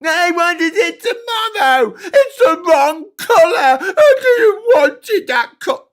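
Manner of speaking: very high, loud, mock-sobbing teen whine; mock tantrum of a rich teen over her new car; spoiled brat crying. Spoiled girl voiced again.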